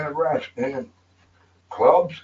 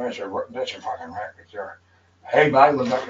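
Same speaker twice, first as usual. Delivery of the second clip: ordinary talk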